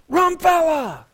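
high yelled greeting from down the road; shouted call. Retold or imitated. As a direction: call out loud and high to get his attention. Friend yelling from bicycle.

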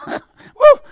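high joyful woo with a laugh; excited whoop. Real, not acted. Radio preacher gets carried away, whooping woo. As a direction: let out a happy shout of joy. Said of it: "I get all excited".

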